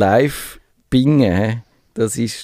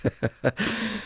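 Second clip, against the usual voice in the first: soft high laugh; 9 semitones higher, 8 dB quieter than usual. Real, not acted. Laughing softly after joke.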